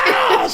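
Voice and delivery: high startled yelp; mock yelp. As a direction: yelp as the chairs go down. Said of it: "he's like".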